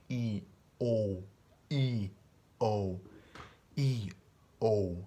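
E and O with the mouth stretched wide; stretched vowels. Shown as a demonstration. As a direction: exaggerate E and O, mouth wide open. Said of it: "You have to really stretch your mouth".

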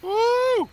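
high, loud whoop; whoop of relief. Real, not acted. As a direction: let out a high celebratory whoop. Relief after an intense fight.

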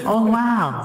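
high, drawn-out amazed exclamation; Owen Wilson. Acted. Comic showing off a catchphrase, Owen Wilson impression. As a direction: float the wow up high and let it fall. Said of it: "my Owen Wilson impression".

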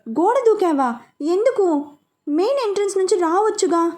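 alarmed and pressing, voice lifted high; worried. A narrator's reading. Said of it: "అని అడిగాడు కంగారుగా".

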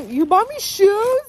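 high, loud, drawn-out surprised question; delighted disbelief. Real, not acted. Girlfriend to boyfriend on learning of shoes, delighted disbelief. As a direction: squeal the question in real surprise.

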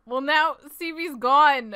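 usual speaking voice

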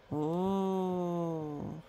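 ordinary delivery, nothing marked

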